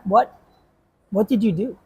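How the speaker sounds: normal talking voice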